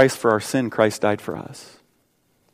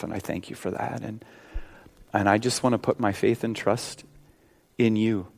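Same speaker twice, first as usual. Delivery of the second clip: leading a prayer; 3 dB louder than usual. Acted. Pastor praying with the congregation, earnest prayer. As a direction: pray aloud for the whole room.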